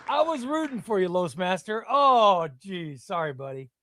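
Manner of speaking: ordinary talk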